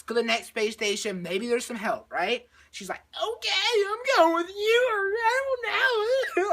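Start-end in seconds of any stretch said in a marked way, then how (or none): 3.1-6.5 high, loud, wailing whine; hammed-up crying through the words; mock tearful. She agrees to go along, in mock tears. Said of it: "she's, like, in tears or something".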